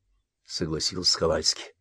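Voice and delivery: plain reading voice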